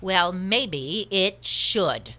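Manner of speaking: loud, very slow, drawn-out comeback; defiant retort. Acted. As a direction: land the last word with weight. Acted quarrel, defiant last word.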